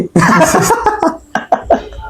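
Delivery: high, soft giggle; soft laugh. Real, not acted. Giggling after mangling the twister. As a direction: giggle at own mistake.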